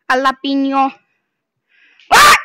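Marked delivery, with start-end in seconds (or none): 2.1-2.4 sudden frightened yell; startled scream. Real, not acted. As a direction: yelp in fright. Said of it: "You scared me".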